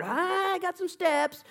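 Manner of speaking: very high, very slow put-on voice; smug believer. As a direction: drag it out, proud of his plan. Acted believer's smug answer.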